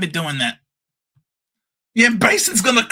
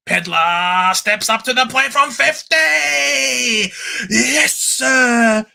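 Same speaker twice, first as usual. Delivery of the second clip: mock commentator call; 5 semitones higher, slower than usual.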